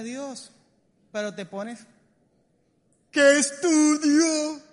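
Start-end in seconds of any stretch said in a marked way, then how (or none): 3.2-4.6 loud, long-drawn wail; hammed-up agonized shout; mock desperate cry. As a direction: wail it out very slowly. Acts out agonizing over career.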